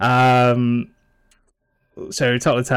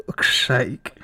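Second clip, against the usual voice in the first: whispered curse; 4 dB louder than usual. Real, not acted. Muttered after a slip.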